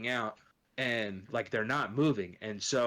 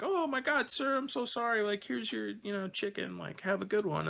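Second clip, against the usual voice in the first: put-on much higher, girlish apology voice; apologetic girl; 11 semitones higher than usual. Girl apologizing to a waiting customer, high mimicked voice. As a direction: imitate a flustered girl in a high voice. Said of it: "this girl, you know, she's like".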